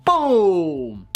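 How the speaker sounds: drawn-out, falling boom; triumphant exclamation. Shown as a demonstration. Teacher celebrating a huge number in English, triumphant. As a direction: punch it out in triumph.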